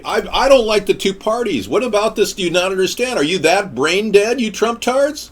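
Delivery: high, strained ranting; exasperated rant. Acted. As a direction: screech it at the fools. Ranting at Trump fans.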